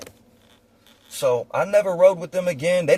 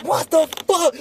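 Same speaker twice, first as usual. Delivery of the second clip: high startled exclamation; imitating shocked friends; 13 semitones higher than usual. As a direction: play the panicked passengers. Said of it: "everybody in the car was like".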